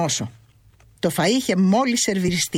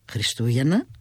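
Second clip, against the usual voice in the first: Χριστούγεννα asked lower, rising at the end in disbelief; surprised question; 6 semitones lower than usual. A narrator's reading. Son echoes his mother's answer with a surprised rising Χριστούγεννα. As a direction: echo Χριστούγεννα back, puzzled. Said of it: "Χριστούγεννα είναι".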